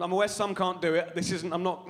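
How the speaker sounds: ordinary delivery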